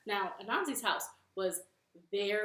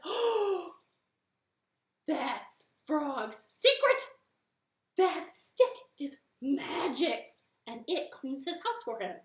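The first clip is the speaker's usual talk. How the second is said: excited spider; 3 semitones higher, 6 dB louder than usual. Acted. Anansi discovers secret.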